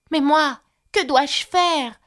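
high, slow, plaintive question; a lightly voiced young girl asking; girlish Alice. A narrator's reading. Alice pleading with the footman.

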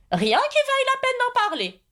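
very high knight voice, brushing it off; indifferent. A narrator's reading. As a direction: dismiss it in a high character voice. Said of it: "comme s'il lui était tout à fait indifférent". (chevalier indifférent, voix très aiguë)

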